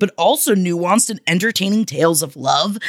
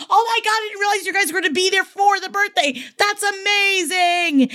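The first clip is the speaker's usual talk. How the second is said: high, fast gush, then slowing; excited; 10 semitones higher than usual. Real, not acted. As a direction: gush with surprise and joy.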